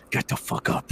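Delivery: voiceless, hushed threat; menacing order. Retold or imitated. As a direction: act out a seething officer under his breath. Retold warrant officer's menacing order.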